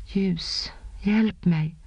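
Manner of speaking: ordinary delivery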